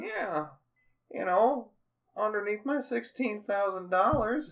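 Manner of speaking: high, put-on old man voice. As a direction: play a sly old man. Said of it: "You got a good Bob voice".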